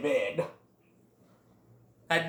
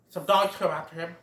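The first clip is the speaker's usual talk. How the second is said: usual speaking voice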